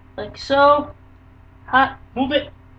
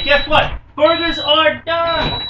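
higher, drawn-out cheer; excited announcement; 5 semitones higher, slower than usual. Acted. Cooking host tells viewers the burgers are done, excited. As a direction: sing it out like good news.

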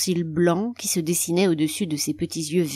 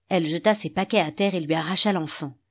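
usual speaking voice

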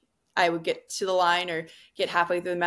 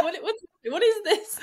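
laughing panic; 13 semitones higher than usual. Retold or imitated. Retelling panic before Olympic match.

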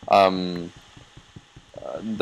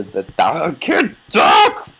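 flustered; 4 semitones higher, 3 dB louder, slower than usual. Real, not acted. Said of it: "Okay, calm down, [name]".

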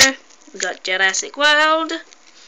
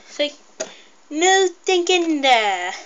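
ordinary delivery

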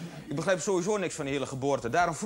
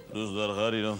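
deep put-on little girl voice; low twisted voice for a little girl; disguised deep voice; 9 semitones lower than usual. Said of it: "met een verdraaide stem gaan praten".

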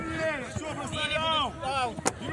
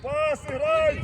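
ordinary talk

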